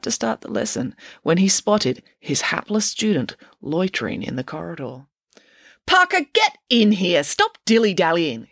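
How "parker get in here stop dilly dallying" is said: high, raised teacher's snap; snapping. A narrator's reading. Teacher to student loitering in the corridor, snapping. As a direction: snap an order at a dawdling pupil. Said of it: "he snapped".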